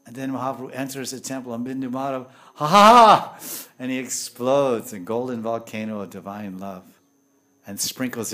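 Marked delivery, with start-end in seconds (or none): 2.5-3.4 loud, high acted cry of ecstasy; soaring exultant "haaa"; ecstatic outburst. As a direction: burst out in rapture. Acts out Mahaprabhu's ecstasy exploding.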